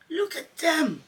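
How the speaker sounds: high, loud exclamation; crying out. A narrator's reading. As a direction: shout with pointing excitement. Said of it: "he cried".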